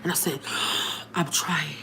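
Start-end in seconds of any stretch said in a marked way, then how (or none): 0.5-1.1 sharp winded gasp; gasp for air. Retold or imitated. Winded, crying, out of breath. 1.1-1.8 winded, struggling for air; out of breath. Retold or imitated. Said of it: "I'm winded at this point".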